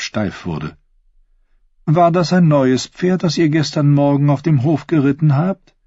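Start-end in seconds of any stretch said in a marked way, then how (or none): 1.9-5.7 higher-pitched royal lady; inviting queen. Acted. Queen inviting Sir Osney to sit with her, high inviting voice.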